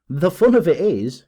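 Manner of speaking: high, loud gleeful outburst; amused cry. A narrator's reading. Detective mocking his rival, amused loud cry. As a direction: blurt it out in great amusement. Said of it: "he cried".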